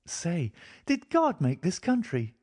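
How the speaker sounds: put-on high, childlike voice of a thirsty girl; a small girl speaking innocently; little girl. A narrator's reading. Girl asks her companion for water, childlike.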